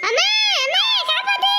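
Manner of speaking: high panicked cry for help; terrified scream for mother. Acted. Frightened girl screams for mother. (பயத்தில் அம்மாவை அலறி கூப்பிடுதல்)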